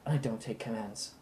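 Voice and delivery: low, soft, cold warning. Acted. Camille warns the thug at wandpoint, low and cold. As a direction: say it quietly with a wand pointed. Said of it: "she says".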